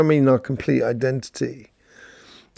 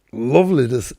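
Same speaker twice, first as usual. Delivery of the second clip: a brief, deliberately bad Scottish accent; half-hearted mock Scottish accent, cut off quickly. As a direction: greet them in a hammy, bad Scottish accent. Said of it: "That's a really bad Scottish accent".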